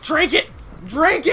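high, loud barked urging; urgent shouting. Real, not acted. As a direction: yell it twice, urgently. Urging character to drink.

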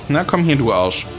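normal talking voice

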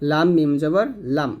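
ordinary talk, nothing marked

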